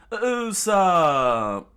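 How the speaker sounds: high, loud cry; scream of a name. Acted. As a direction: scream his friend's name. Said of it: "Chopper screamed out loud".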